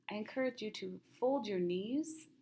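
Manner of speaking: usual speaking voice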